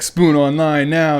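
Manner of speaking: drill instructor command. Retold or imitated. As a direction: bark it again, sharper.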